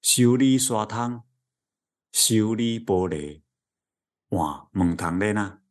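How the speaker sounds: slow drawn-out hawker call; street vendor call. Retold or imitated. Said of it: "我这个节奏完全是学他的哦". (慢慢拉长的叫卖声)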